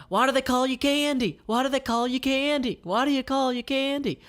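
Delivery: put-on very high, loud toddler voice; three-year-old yelling. Toddler Jackie bouncing on the bed, yelling very high. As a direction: shriek it like a hyper little kid.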